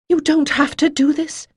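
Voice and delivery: high, loud pleading. A narrator's reading. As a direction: beg her not to go through with it. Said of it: "she pleaded".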